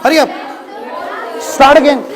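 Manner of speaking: loud, forceful calls to speak up; shouted coaching. Real, not acted. Teacher pushing student to project, loud shouting.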